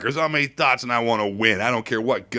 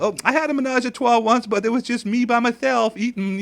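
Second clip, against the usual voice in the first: much higher, smug bragging voice; mock pissant; 11 semitones higher than usual. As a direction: parody a smug show-off. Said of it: "the pissants like to say".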